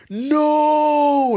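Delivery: high, loud hammed-up cry; mock yell. Manager begs the customer not to leave, hammed-up high yell. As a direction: yell it like a comic plea. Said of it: "Moses yells".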